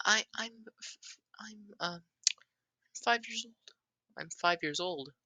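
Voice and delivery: put-on very high, hesitant child voice; shy five-year-old. Voices five-year-old Doma stammering his age.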